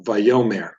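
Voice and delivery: "vayomer" with the stress on YO; vay-YO-mer, leaning on the middle of "vayomer"; stress on second syllable. Shown as a demonstration. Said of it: "The stress is placed right here".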